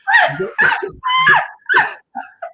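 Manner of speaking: loud high burst of laughter; big laughing shout. Real, not acted. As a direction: let out a whooping laugh. Bursts out laughing at own joke.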